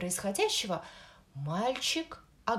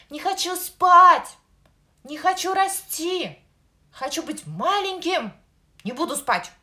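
high, loud tantrum yell; louder shout; 9 semitones higher, 13 dB louder than usual. Acted. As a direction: scream it like a stubborn toddler. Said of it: "Ещё громче закричал малыш". (ещё громче закричал малыш)

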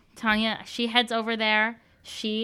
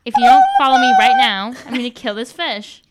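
shouted threat; 12 dB louder than usual. Acted. Threatening the captain.

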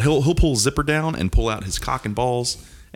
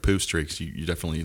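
ordinary talk, nothing marked

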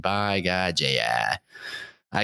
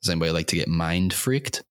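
usual speaking voice

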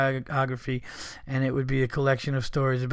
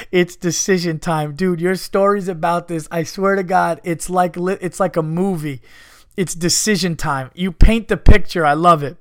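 excited; 4 semitones higher, 9 dB louder than usual. Real, not acted. Co-host excitedly praising story.

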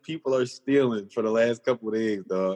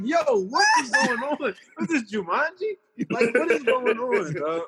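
much higher, incredulous yelp; stunned disbelief; 10 semitones higher than usual. Retold or imitated. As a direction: act like the world's gone crazy, voice shooting up. Own reaction to monkey headlines.

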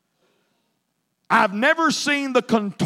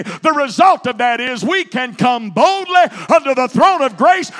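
climbing louder and higher into a whooping preach; fervent gospel preacher, higher and louder; preaching at full force; 5 semitones higher, 4 dB louder than usual. Acted. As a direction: build the sermon to a ringing climax. Sermon builds to full force.

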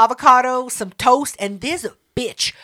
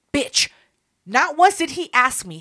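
normal talking voice

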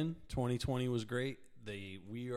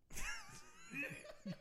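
big laugh; 10 dB quieter than usual. Real, not acted. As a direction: burst of high laughter. Laughing at hiccup mention.

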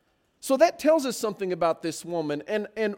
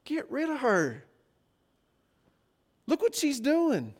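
higher-pitched, mildly exasperated grumble; annoyed complaint; 6 semitones higher, 3 dB quieter than usual. Acted. Disciples urge Jesus about the following woman, annoyed complaint.

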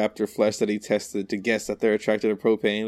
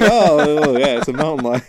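louder, laughing through a mock "Oh, yeah, it's a mountain lion"; cracking up while mimicking the lame excuse; laughing mockery; 3 semitones higher, 7 dB louder than usual.

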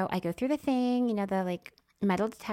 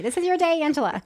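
high, loud gleeful cheer; excited cheer; 8 semitones higher, 6 dB louder than usual. Retold or imitated. Celebrating an empty row, loud excited cheer. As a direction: cheer yourself on. Self-congratulation.

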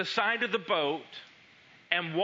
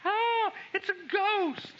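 a much higher, slow, mock-terrified wail; terrified cry; 13 semitones higher, slower than usual. Disciples in the boat seeing Jesus, mock-terrified high cry. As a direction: cry out as if a ghost rose from the sea. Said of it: "they cried out in terror".

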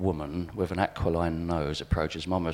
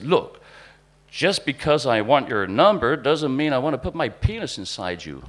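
raised, high-pitched, loud protest; loud indignant retort; 8 semitones higher, 9 dB louder than usual. Retold or imitated. Man following someone, protesting about wanting a number, loud and indignant. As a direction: blurt it out loudly, pitched well up. Man's overheard retort, retold.

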